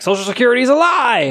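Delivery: high, sudden yell to spook; jump scare shout. Pretend. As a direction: shriek it to scare people. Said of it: "I just jump out and I'm like".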